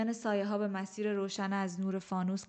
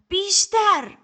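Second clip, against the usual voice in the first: high, much louder all-out yell; shouted order; 12 semitones higher, 13 dB louder, much slower than usual. A narrator's reading. Teacher screaming at her pupil to give more. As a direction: scream the word at her to push. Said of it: "باگرا فریاد زد".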